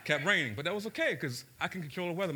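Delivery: normal talking voice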